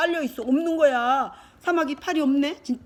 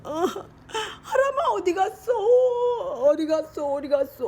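mock-sobbing search for a lost brother; raised, wailing calls, hammed up; tearful calling; 5 semitones higher than usual. Brothers calling for each other. (울먹이며 애타게 동생을 찾는 시늉)

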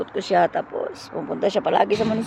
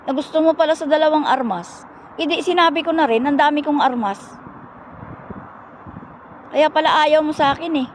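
higher-pitched, mildly indignant retelling; retold reproach; 5 semitones higher than usual. Mayor confronts her for choosing a soldier, retold reproach. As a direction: imitate someone confronting you over a rejection.

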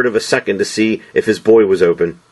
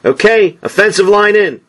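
coach calling out; 10 semitones higher than usual. A narrator's reading. Coach calls his players in.

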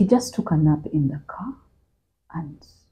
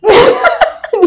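a high burst of nervous laughter; 6 dB louder than usual. Real, not acted. Recalling she thought she would die, nervous laugh.

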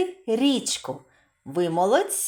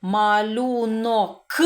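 Малюнок drawn out very slowly; slow sound-out; much slower than usual. Shown as a demonstration. Teacher slowly drawing out Малюнок. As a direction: stretch Малюнок slowly for the class. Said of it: "Позначаємо кружечком".

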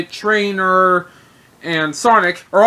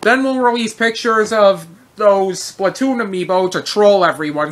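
ordinary delivery

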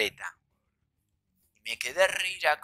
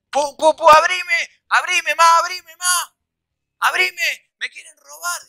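high, loud mock panic; frantic high-pitched cries for mother; panicked begging; 12 semitones higher, 8 dB louder than usual. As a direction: mimic pounding and pleading to be let in. Imitates rival begging to be let in.

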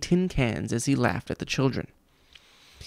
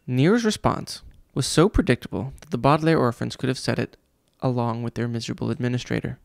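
ordinary delivery

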